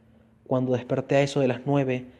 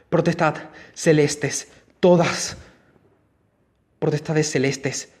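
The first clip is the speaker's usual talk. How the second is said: louder, higher panicked cries; screaming for help; 3 semitones higher, 4 dB louder, slower than usual. A narrator's reading. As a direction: scream it in terror. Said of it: "Grité". (gritos aterrados de socorro)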